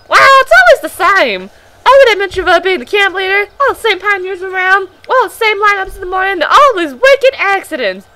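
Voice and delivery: strained high crow voice; crow-like pioneer voice. Acted. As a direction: make him sound like a crow. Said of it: "making him sound like a crow".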